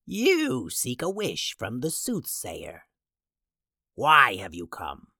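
slow eerie witch voice; slow, otherworldly crone; soothsayer voice. Acted. Soothsayer character voice.

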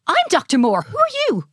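high, excitable mad scientist; lightly voiced dotty inventor; eccentric scientist. Scientist introduces himself.

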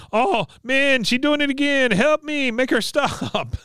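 high, wailing accented impression; Khan. Khan begging for help with his daughter, high mock wail. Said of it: "Khan comes in and goes".

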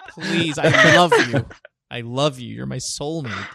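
mock pleading cheating husband; lightly voiced begging lover; love bombing. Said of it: "cheating husband one oh one".

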